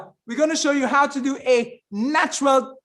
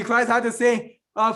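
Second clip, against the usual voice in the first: ordinary delivery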